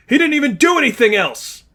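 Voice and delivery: high, loud enraged cry; furious outburst. Acted. Zane enraged at Lipov, loud furious shout. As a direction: spit the insult.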